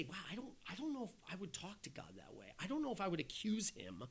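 a cautious believer's hushed doubt; hesitant timid doubt. Retold or imitated. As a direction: shrink back at the thought. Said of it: "we might say".

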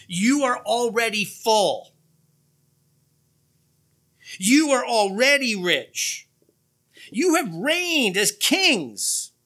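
loud, high, drawn-out mock praise; dripping sarcasm. Preacher reads Paul to the congregation, dripping with sarcasm. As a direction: stretch each claim slowly with heavy sarcasm. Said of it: "oozing and dripping with sarcasm".

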